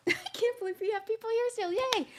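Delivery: breathless, giddy and high; delighted squeal at the viewers; excited. Real, not acted. Back breathless, excited to see viewers.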